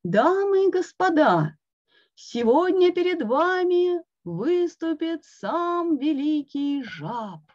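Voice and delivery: high, slow grand announcer; a showman booming out the big act. A narrator's reading. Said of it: "незнакомый голос".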